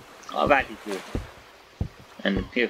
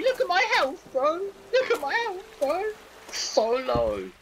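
much higher, wound-up telling; excited disbelief; slower than usual. Real, not acted. Excited telling of shark attack.

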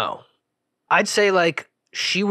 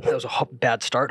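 ordinary talk

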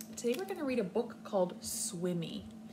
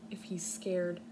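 ordinary talk

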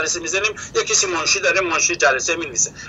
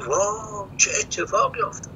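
much softer, half-whispered, taken aback; hushed surprise; 8 dB quieter, slower than usual. Retold or imitated. Muhammad watching Abdullah storm out, hushed surprise. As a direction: gasp it out quietly, caught off guard.

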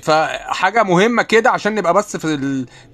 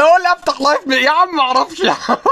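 high, loud, whiny imitation of a clueless friend; mocking friend; 12 semitones higher, 6 dB louder than usual. Retelling how a friend begged him to open a live, mock whiny high voice. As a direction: ham up a helpless friend's pleading, higher and louder. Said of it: "قال لي".

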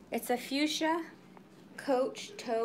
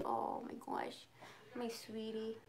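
softer, breathy pet talk; whispered cooing; 3 semitones lower, 12 dB quieter than usual. Real, not acted. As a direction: murmur fondly to the cat. Cooing to the cat.

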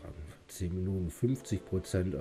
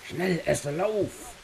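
urgent shout; 11 semitones higher, 4 dB louder, slower than usual. Real, not acted. Urging companion to flee.

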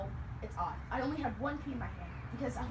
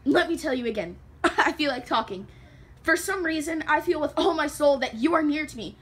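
loud, impassioned begging for help; desperate plea; 3 semitones higher, 11 dB louder than usual. Acted. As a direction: pour out the soul at full voice, building into a plea.

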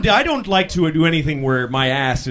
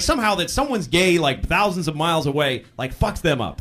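ordinary delivery

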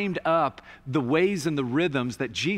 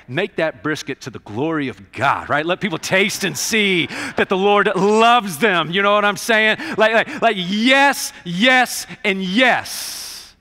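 loud, high, slower yes-yes build; loud high preaching to the crowd; fired-up preaching; 5 semitones higher, 8 dB louder, slower than usual. Real, not acted. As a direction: sell the joy at full voice. Preaching climax.

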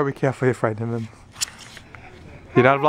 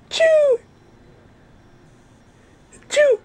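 fake high sneeze; 4 dB louder, slower than usual. Fake sneeze to amuse baby.

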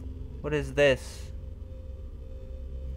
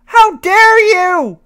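outraged, higher; 14 semitones higher, 11 dB louder, slower than usual. Real, not acted. Repeated, higher.

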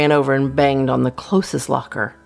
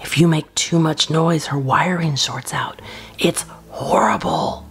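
breathy scary-secret whisper; conspiratorial whisper. Acted. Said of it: "I whispered".

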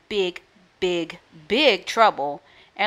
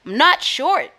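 higher, louder protest; indignant yell back; 8 semitones higher, 6 dB louder than usual. A narrator's reading. Norton answering his sister's insult, yelling back. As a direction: yell back like an offended brother. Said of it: "Norton Snorton yelled back".

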